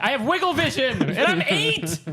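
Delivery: put-on high, giggly kid voice; mock little kid voice. Friend mocking a boastful kid, high and giggly. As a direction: play a goofy eight-year-old, laughing. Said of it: "He's like".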